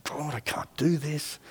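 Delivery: very soft, slow plea to God; mock-panicked private prayer, hushed and drawn out. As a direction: plead under your breath. Silent panicked prayer, heart beating.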